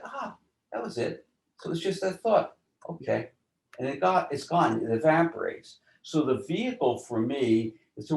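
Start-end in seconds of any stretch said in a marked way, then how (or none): 0.0-2.6 realizing aha. Retold or imitated. Self-realization retold, high pitch.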